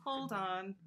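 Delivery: usual speaking voice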